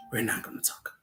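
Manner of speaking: hushed conspiratorial whisper; playful whispered aside. Real, not acted. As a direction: drop to a secret whisper. Teasing aside, cuts herself off.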